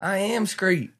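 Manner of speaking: high-pitched mock brag; imitated boasting fisherman. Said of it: "He said".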